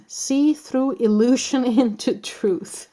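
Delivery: real laugh breaking into the words; slow reading that tips into laughter. Real, not acted. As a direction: read it out, giggling through the end. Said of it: "I'm sorry that I'm laughing".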